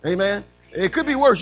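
ordinary talk, nothing marked

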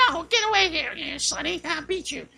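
put-on high, cranky old lady snapping back; grumpy old lady. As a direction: play a cranky little old lady shooing him off.

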